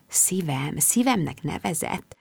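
soft, almost whispered aside; hushed wonder. A narrator's reading. Woman marvelling that he called her darling, hushed wonder. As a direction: breathe it softly to yourself, drawn out at the end.

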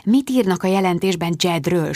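ordinary delivery, nothing marked

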